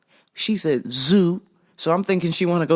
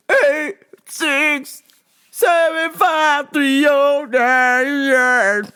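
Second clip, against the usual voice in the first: high mock voice reading wrong numbers; imitating customer; 8 semitones higher, 3 dB louder, much slower than usual. Comedian mimics caller reading back wrong numbers, high mock voice. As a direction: rattle them off like the confused caller. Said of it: "read the wrong numbers back".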